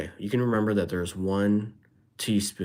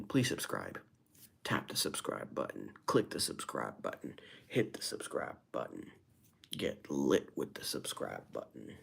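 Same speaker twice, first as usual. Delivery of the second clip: much lower, soft murmur; low-voiced plug; 9 semitones lower, 8 dB quieter than usual. Streamer asking viewers to subscribe, low murmur.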